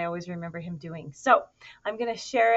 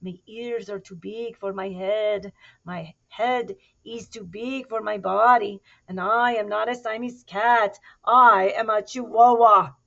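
put-on Spanish accent; 3 semitones higher, 10 dB louder than usual. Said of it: "using his very best Spanish accent".

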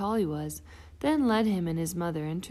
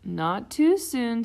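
wry doctor; 5 semitones higher, 7 dB louder, slower than usual. Acted. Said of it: "said Doctor Mugwort".

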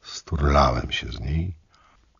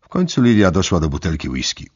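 ordinary delivery, nothing marked

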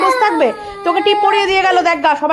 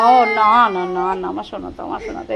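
soothing; 10 semitones lower, 4 dB quieter than usual. Real, not acted. Mother soothing her crying son.

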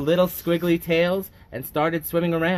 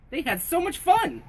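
much higher, joyful exclamation; happy storybook exclamation; excited; 13 semitones higher than usual. A narrator's reading. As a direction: burst out with delight. Narrator exclaims.

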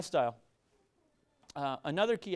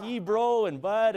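Valley accent; 5 semitones higher, 7 dB louder, much slower than usual. Shown as a demonstration. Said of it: "that Valley accent going".

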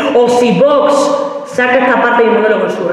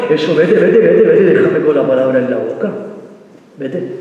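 put-on boyfriend's voice; 5 semitones lower than usual. Comic imitating boyfriend telling girlfriend to leave, dismissive. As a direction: lower mock boyfriend, dismissive.

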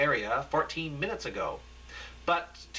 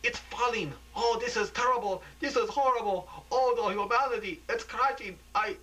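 horrified live report; 8 semitones higher than usual. Acted. Reporter Dave describes the hotel falling, high horrified cries. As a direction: report the collapse in mounting horror. Reporter sees tower collapse.

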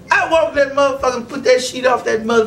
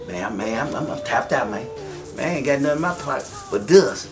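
put-on much lower, softer stammering old man; broke old pimp voice; 11 semitones lower, 6 dB quieter than usual. Old pimp telling the comic he has no money, low stammer.

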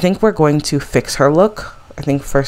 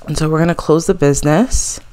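ordinary talk, nothing marked

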